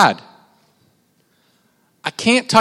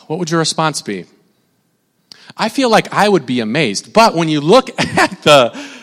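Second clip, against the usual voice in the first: normal talking voice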